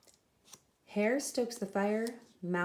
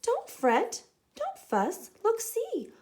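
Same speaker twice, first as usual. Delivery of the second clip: high, louder comforting squeak; squeaky mouse; 4 semitones higher, 5 dB louder than usual. Acted. Mouse comforts the sad bear, high reassuring squeak. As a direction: soothe the bear in a tiny voice. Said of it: "Mouse squeaks".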